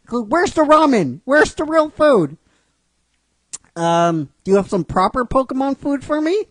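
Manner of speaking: high, loud pleading little voice; demanding Pikachu. Acted. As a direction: demand food like a hungry pet.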